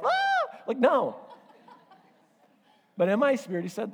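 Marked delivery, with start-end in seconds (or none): 0.0-0.6 shrill mock shriek of fright, very high; startled scream. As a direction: yelp like you just saw an angel. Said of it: "'cause you're like".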